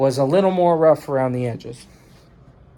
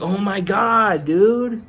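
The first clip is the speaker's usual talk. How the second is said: dismayed disbelief; 7 semitones higher, slower than usual. Retold or imitated. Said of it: "and I'm like".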